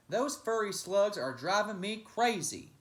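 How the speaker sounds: grumpy, loud complaint; mean giant grumbling to his friends; complaining. Acted. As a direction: gripe about the pests. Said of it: "he complained".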